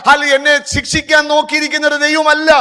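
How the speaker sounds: loud, high sermon voice, building; a pastor proclaiming God's mercy, loud and high; fiery preaching. Acted. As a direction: preach the mercy of God with full force. Sermon rises again.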